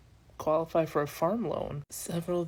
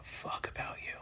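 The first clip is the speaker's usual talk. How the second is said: whispered aside; 11 dB quieter than usual. Real, not acted. Snide aside about America.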